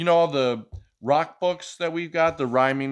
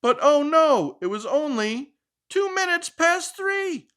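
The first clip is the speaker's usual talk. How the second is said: mock dismay; 13 semitones higher than usual. A narrator's reading. Dramatic story beat.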